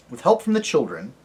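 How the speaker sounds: ordinary delivery, nothing marked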